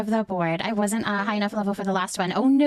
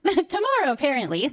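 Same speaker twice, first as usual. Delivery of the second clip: laughing through the words, higher; 7 semitones higher than usual. Real, not acted. Streamer laughing at her own date mix-up. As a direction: giggle out the correction.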